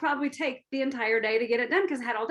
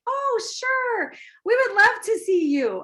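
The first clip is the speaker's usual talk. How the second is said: high, drawn-out sugary politeness; sweetest little voice; 8 semitones higher, slower than usual. Acted. Host answering a surprise visitor on the phone, sickly sweet. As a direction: put on a sweet, much higher little voice. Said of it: "in your sweetest little voice".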